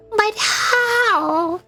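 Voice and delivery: high-pitched, baffled outburst; incredulous. Real, not acted. Streamer reacting to luck in the game, incredulous. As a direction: jump up in pitch in disbelief.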